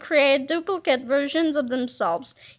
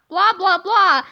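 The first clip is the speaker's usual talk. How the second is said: grumpy blah; 3 semitones higher, 5 dB louder than usual. Acted.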